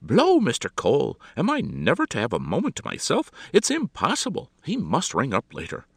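high, loud, put-upon outburst; imperious mistress to her maid; exasperated society hostess. A narrator's reading. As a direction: complain grandly at being interrupted.

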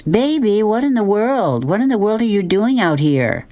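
high-pitched mother in disbelief; high, dismayed mother's voice; exasperated mother. Acted. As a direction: voice a fussing mother, pitched well up.

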